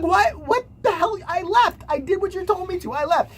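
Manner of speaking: high-pitched indignant teacher; mock outraged protest. Teacher protesting to the officer, mock outrage.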